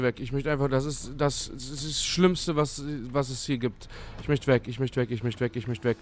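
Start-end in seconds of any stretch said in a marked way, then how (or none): none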